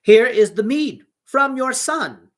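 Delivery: high, loud and slow, every word spelled out; loud slow shout. A narrator's reading. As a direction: shout it slowly as loud as he can. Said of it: "He shouted the same words again as loud as he could".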